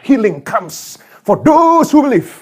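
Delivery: high, loud, drawn-out proclamation; mock a hard-selling healing preacher, slow and loud; prosperity preacher. Preacher selling healing to believers, hammed-up and loud.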